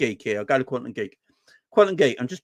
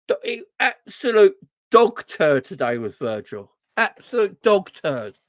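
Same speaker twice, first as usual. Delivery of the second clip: angry; 7 semitones higher, 4 dB louder than usual. Real, not acted. Still fuming about the captain.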